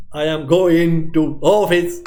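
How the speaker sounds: slow, broken words with sudden loud bursts; staccato speech. Shown as a demonstration. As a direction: demonstrate staccato speech, jerking the volume word by word. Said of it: "if I have got this particular staccato speech".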